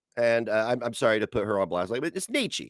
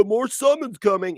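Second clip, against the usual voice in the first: higher, sneering mock voice of a laughing troll; 8 semitones higher than usual. Mocking the trolls who taunt him, higher and sneering. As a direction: taunt like an internet troll gloating. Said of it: "They think it's funny to troll and be like".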